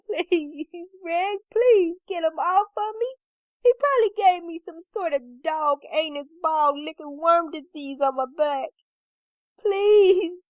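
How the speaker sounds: high, wailing panic breaking into shouts; hysterical pleading. A narrator's reading. Crying wife begging Red to get dog off, hysterical. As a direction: plead frantically, ending in a loud cry.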